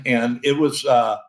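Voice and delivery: ordinary delivery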